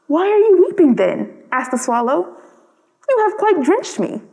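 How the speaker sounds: high, startled swallow; surprised question. Acted. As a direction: ask in bright surprise.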